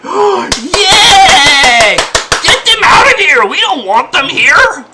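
high, loud, fast mock cheer; hammed-up gleeful cultists; mocking cheering crowd. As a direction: parody the gleeful mob. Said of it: "the cultists jump behind them and say".

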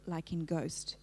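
ordinary talk

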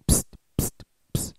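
sharp whispered psst calls; psst, psst, a street call; imitated psst hiss. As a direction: hiss to catch a passerby. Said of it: "the prostitute was like".

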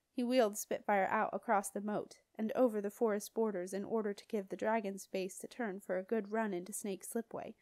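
plain reading voice

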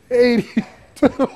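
drawn out, stifling a laugh; slow giggly repeat. Real, not acted. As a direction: say it slowly, barely holding laughter. Repeats number, cracking up.

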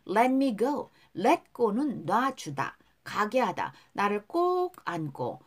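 ordinary talk

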